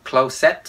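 "closet" misread as "clow-SET", rising at the end; wrong closet. Shown as a demonstration. Teacher shows learners what to avoid, "closet" as clow-set. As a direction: give "closet" a long o and a full "set". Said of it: "không phải là".

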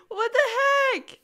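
high, loud, drawn-out protest; outraged yell. Real, not acted. Player crying rigged at the hunt, outraged yell. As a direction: yell foul at the result.

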